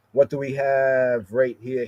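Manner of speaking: usual speaking voice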